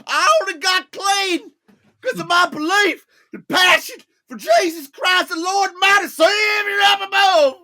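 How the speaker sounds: high put-on voice building to a loud shout; hammed-up preachy testimony. Imitating [name] testimony.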